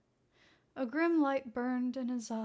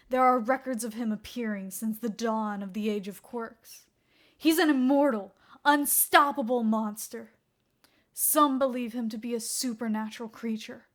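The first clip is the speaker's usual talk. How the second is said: fevered rant; 8 dB louder than usual. Acted. Obsessed detective ranting.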